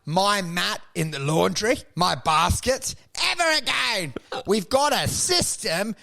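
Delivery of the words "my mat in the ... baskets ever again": higher, slow mock fury; furious yelling. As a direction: rage at the one who exposed them, drawn out. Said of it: "they are furious and going".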